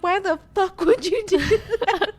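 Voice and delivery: put-on angry boyfriend. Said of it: "my man's all like".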